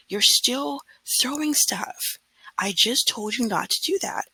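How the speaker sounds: high, drawn-out scolding, easing slightly; retold scream. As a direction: re-enact yelling at a class, words stretched out at first. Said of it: "I literally screamed it".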